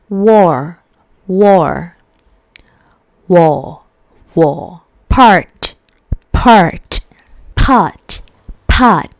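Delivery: car, war, part, store and hair said with a strong American R; American rhotic R. Shown as a demonstration. Teacher drilling rhotic words for learners, with the R pronounced. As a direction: drill each word with the R clearly pronounced. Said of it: "So, for example".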